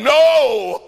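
a loud, high, final no; emphatic no. Acted. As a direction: bark it back, refusing flatly. John's emphatic denial, acted quote.

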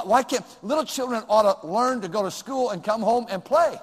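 ordinary talk

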